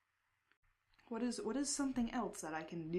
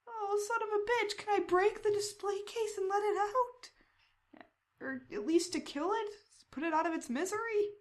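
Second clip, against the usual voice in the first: mock outrage; 13 semitones higher, 8 dB louder than usual. Player outraged at display case.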